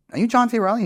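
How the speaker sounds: high, loud startled call of recognition; excited stranger. Retold or imitated. Stranger on subway spotting the actor, high excited call. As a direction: blurt out the name like a starstruck stranger.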